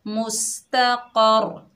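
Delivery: मुस्तक़र read slowly, syllable by syllable; slow tajweed demo of मुस्तक़र; demonstrated reading. Shown as a demonstration. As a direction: sound out मुस्तक़र slowly and clearly. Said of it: "जैसे".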